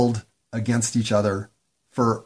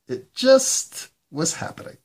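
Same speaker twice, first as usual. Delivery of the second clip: much higher, squeaky echo of the line; high squeaky repeat; 13 semitones higher than usual. Acted. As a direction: jump way up in pitch and squeak it.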